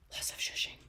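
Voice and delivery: very soft whispered aside; hushed, voiceless echo. Real, not acted. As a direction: whisper it to yourself. Repeats it to self, whispered.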